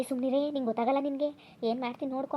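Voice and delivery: ordinary delivery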